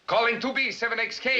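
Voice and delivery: high-pitched call sign; radio call. Acted. Operator calling a station that won't answer, radio call. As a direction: hail a distant station over the radio.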